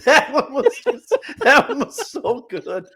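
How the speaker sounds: high-pitched words through real laughter; can barely talk for laughing; laughing hard. Real, not acted. Cohost laughing hard at the impression, asking to clip it. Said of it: "you gotta clip that one out".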